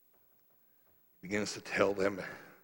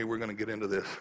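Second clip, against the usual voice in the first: ordinary talk, nothing marked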